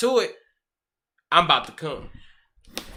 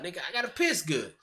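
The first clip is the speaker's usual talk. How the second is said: ordinary delivery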